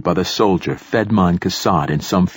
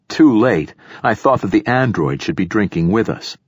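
ordinary delivery

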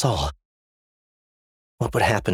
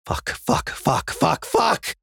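from a breathy hiss to a high panicked cry; rising panic; 9 semitones higher, much slower than usual. Acted. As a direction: panic building with every word. Trapped, monsters approaching.

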